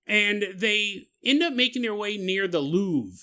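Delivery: normal talking voice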